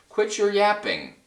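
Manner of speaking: high, dismissive retort; calling back. A narrator's reading. Eagle tells chasing Horton off, calling back. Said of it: "over his shoulder called back".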